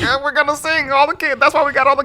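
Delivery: high-pitched mock excuse-making; imitating a nervous cover story; flustered excuse. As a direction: explain yourself in a high put-on voice.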